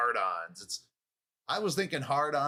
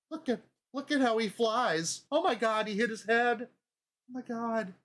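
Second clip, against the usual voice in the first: very high-pitched hammed-up horror; horrified mom; 10 semitones higher than usual. Mom believing Biden was really thrown away, mock horrified. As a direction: play a gullible mom, aghast in falsetto. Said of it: "And then Mom starts believing".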